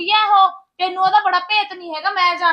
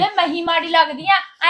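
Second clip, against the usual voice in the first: usual speaking voice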